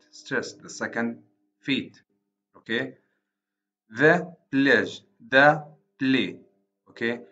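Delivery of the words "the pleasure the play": "pleasure" and "play" said louder, beat by beat; syllable stress demo. Shown as a demonstration. Teacher scanning a line for students, stressing beats. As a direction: mark each stressed syllable louder for the class. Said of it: "pleasure here is divided into two syllables".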